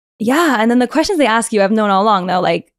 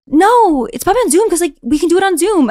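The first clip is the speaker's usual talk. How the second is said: higher reassuring rabbi voice; imitating rabbi; 7 semitones higher, 4 dB louder than usual. Rabbi reassures her about Zoom, mock higher. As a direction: play the rabbi brushing it off. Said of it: "And he was like".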